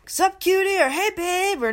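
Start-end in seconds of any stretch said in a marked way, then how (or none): 0.1-0.8 high, loud mock street catcall; imitating a catcaller shouting sup cutie; catcaller yell. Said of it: "someone yells at me". 0.9-1.6 high mock catcall; catcaller call. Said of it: "someone yells at me".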